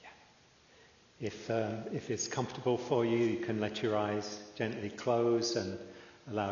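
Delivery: calm, unhurried meditation guide with long pauses; measured, settled voice leaving room to breathe; guided meditation. Real, not acted. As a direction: ease listeners into stillness, phrase by phrase.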